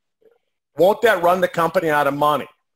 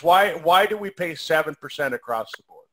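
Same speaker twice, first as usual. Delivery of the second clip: ordinary talk, nothing marked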